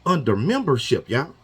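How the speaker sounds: usual speaking voice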